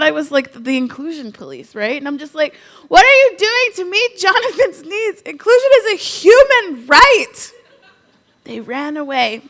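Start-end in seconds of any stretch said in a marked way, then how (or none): 2.9-7.5 higher, louder, slowed crusading demand; hammed-up righteous plea, laughing; mock loud insistence. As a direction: preach it like the inclusion police. Pressing teachers as inclusion police.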